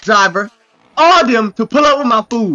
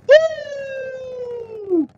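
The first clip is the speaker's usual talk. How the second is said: cheering woo; 14 semitones higher, 6 dB quieter than usual. Real, not acted. Cheering a fast car.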